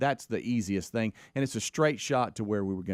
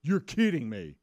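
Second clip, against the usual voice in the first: overdone greeting; 9 semitones higher than usual. Retold or imitated. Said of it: "Over-, overdoing it".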